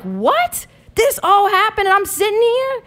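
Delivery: high-pitched, astonished questions; retold incredulity. Daughter learning of a fight she missed, acted incredulous. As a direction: replay the disbelief, voice climbing high.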